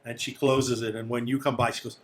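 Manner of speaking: usual speaking voice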